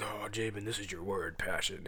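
hushed, low, distant voice of God; divine voice. Acted. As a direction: intone it softly like a heavenly voice. Voicing the Lord speaking to him.

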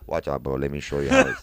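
lightly voiced Persian accent, pitched low. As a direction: imitate a friend's Persian accent a little. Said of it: "my friend has an accent. This guy's Persian".

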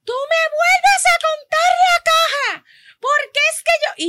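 high, loud, slow mock scream; screaming customer. Customer screaming at the cashier to recount the register. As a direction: shriek like an outraged shopper. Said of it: "Esa señora pegaba a gritar".

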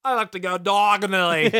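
loud, high, silly Australian twang; mock Australian accent. Host punning on Diagon Alley, mock Australian. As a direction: put on a bad Australian accent. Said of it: "when Australian".

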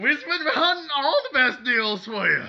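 loud, high sales cowboy; cowboy pitchman. Pretend. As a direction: sell it like a rodeo huckster.

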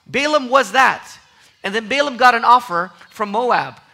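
loud, high, ringing pulpit exhortation; full-force preaching. Acted. Preacher marvels to the congregation, in loud, ringing preaching.

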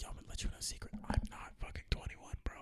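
conspiratorial whisper. Retold or imitated. Him confiding to a guy that he is underage. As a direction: whisper it like a secret.

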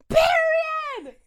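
put-on girl yelling her news; excited yell. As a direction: scream the word across the house. Caller overhears her yell.

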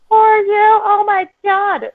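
high, drawn-out gushing sympathy; fussing parent. Acted. Parent standing over fallen kid, exaggerated high-pitched fussing. Parent overreacting to kid's fall.

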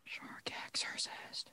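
slow, hushed whisper. Pretend. Co-host whispering to a friend who loves sharks, slow whisper. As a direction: whisper it slowly and very softly.

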